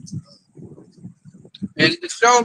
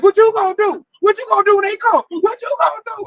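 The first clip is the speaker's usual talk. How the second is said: higher, frantic repeated question; panicked friend; 10 semitones higher than usual. Retold or imitated. Scared coworker begging him for answers, higher and frantic. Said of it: "'Cause he asked".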